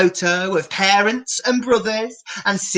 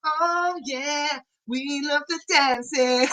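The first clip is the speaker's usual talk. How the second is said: high, softer sing-song; playful; 6 semitones higher, 5 dB quieter than usual. Acted. Presenter grooving with the kids, soft high sing-song. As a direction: groove along gently.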